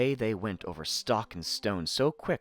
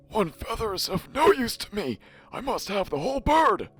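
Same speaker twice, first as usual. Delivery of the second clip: king; 13 semitones higher, 6 dB louder than usual. Acted. King demands the whole bird.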